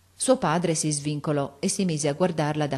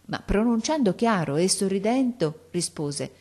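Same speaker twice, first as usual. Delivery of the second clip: ordinary delivery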